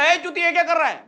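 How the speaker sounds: high, loud mock yell; mock angry yell. Assistant director yelling at the actor, mock angry. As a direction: bark it at the clueless actor. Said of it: "उसने बोला".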